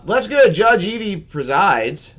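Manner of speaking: loud, high, slow announcer call; show host intro. Acted.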